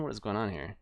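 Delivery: ordinary delivery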